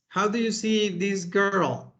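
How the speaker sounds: usual speaking voice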